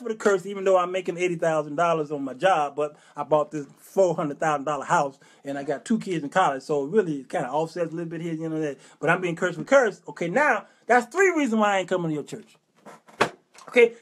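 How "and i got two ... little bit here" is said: ordinary talk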